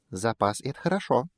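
high squeaky mouse. Acted. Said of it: "пискнул мышонок".

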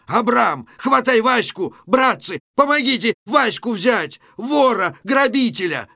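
high, loud alarmed yelling; rally everyone with urgent high shouts; shouting for help. Acted. Kostylyov calling men to seize the thief, shouting.

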